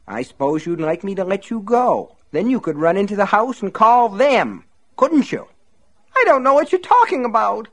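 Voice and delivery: high mocking sarcastic voice; sneering accusation. Buster accuses Myrtle of betraying him, high sarcastic sneer. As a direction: taunt her with sarcasm.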